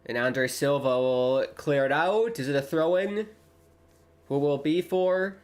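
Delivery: lively live match call; play-by-play commentary. Acted. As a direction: call the action as it happens.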